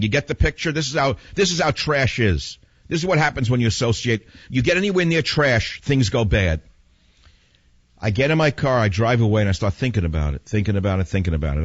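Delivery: normal talking voice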